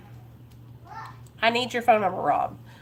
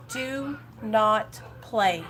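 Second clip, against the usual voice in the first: each word slowly spaced and firm; slow stern warning; 3 dB quieter, much slower than usual. Real, not acted. Host warning recovering viewers, slow and stern. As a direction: lay it down slowly so they listen.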